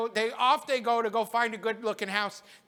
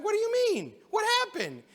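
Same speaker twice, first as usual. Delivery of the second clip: much higher, puzzled asking; mock astonishment from the townsman; surprised question; 12 semitones higher than usual. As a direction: sound startled by the news.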